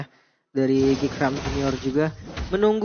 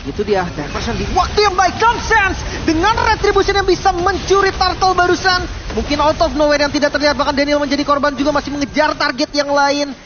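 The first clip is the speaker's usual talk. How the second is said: screaming hype call, much higher and louder; esports caster; 10 semitones higher, 7 dB louder than usual. Acted. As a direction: yell the steal like a big play. Turtle steal call. (komentator esports berteriak heboh)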